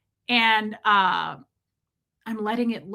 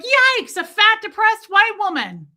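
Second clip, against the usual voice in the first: high, loud mock squeal; comic panicked creature voice; horrified talking snake; 12 semitones higher, 8 dB louder than usual. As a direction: play a snake screeching in alarm. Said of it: "And it was like".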